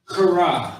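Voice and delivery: "kara" with the k scraped hard in the throat; forceful throaty K. Shown as a demonstration. Teacher contrasting Hebrew words, "kara" forced and throaty. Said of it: "with your damn throat".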